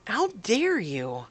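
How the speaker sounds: high, slow indignant outburst; outraged. Acted. Woman who just slapped her partner, outraged. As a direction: draw it out in outrage. Slapped him, acted quarrel.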